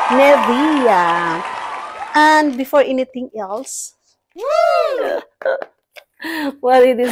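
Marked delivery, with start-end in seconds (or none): 4.4-5.6 short high laugh. Real, not acted. Vlogger showing off her purchases, high laugh. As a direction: break into a high little laugh.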